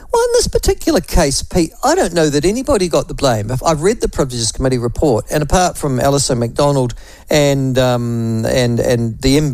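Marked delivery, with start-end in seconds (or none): none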